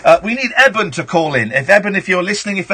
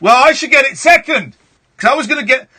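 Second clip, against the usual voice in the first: higher-pitched mock protest; hammed-up indignant whine; complaining listeners; 6 semitones higher than usual. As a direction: imitate listeners squabbling over their turn. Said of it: "people are going".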